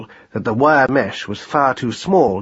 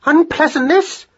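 shrieked question climbing very high; outraged, slow squeal upward; rising note; 4 dB louder than usual. A narrator's reading. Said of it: "cried Madeline on a rising note".

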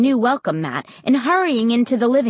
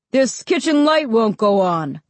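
raised voice from another room; calling out; 3 dB louder than usual. A narrator's reading. Dad calling from the back of the house, raised voice. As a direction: call it through to the others. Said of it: "Dad called from the back".

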